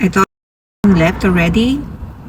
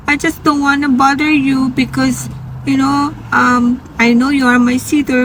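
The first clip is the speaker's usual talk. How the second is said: higher, plaintive retold voice; apologetic patient; 7 semitones higher than usual. Patient explains hesitation to her sitter, high and apologetic. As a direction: voice a patient reluctant to be a bother. Sad patient reluctant to bother.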